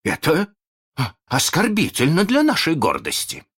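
high, loud indignant protest; offended pirates. A narrator's reading. Pirates protest their leader's disgrace, high indignant voice.

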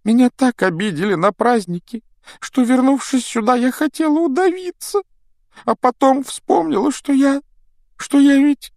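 put-on high, loud whiny ghost girl; nasal whine. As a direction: wail it, self-pitying and high. Myrtle self-pitying.

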